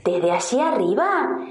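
ordinary delivery

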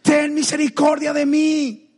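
loud, high, drawn-out pleading shout; crying out. Retold or imitated. Blind man begging Jesus for mercy, crying out. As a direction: belt out a desperate plea. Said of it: "con tu clamor".

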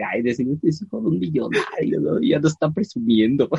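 a light put-on voice for nosy churchgoers, sneering; mock gossip voice. The teller imitates gossiping churchgoers, mock sneering.

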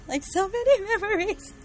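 choked up, laughing through tears; a teary laugh over fond memories; emotional. Real, not acted. Host recalls saying goodbye to the audiobook, teary and laughing. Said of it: "I was getting emotional".

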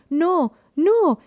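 loud, high-pitched drawn-out cry; screamed refusal. A narrator's reading. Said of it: "gritan seis voces escondidas".

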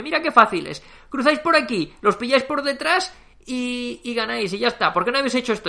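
high, loud mock lecturing; condescending emperor. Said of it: "Les pone un mapa y dice". (Peter explicando el mapa a los generales, condescendiente)

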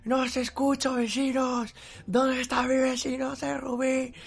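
high, stretched-out call across the street; gritando a los vecinos. Retold or imitated. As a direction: re-enact hollering from a window. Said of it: "todos ahí gritando a la hostia". (voceo agudo y alargado por la ventana)

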